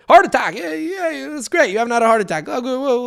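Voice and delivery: high-pitched, mock-eager babble; scrambling improvised toast. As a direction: blurt it high, covering a near slip. Acts out panicked last-minute toast.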